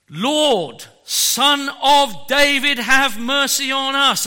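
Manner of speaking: loud, high cry for mercy; desperate shout. Retold or imitated. Preacher voicing blind men crying to Jesus, loud desperate shout. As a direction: cry out to be heard over the crowd. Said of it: "They shouted out".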